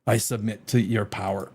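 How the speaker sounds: soft, low mock submission; mock surrender. War College head yielding to the girls, hushed and mocking. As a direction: hand over power in a hushed, defeated voice. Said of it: "He goes".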